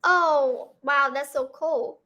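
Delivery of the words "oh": ordinary delivery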